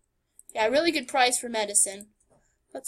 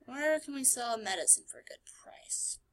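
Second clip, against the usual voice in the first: unvoiced soft whisper; 9 dB quieter than usual. Real, not acted. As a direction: breathe the question out. Whispered reading of question.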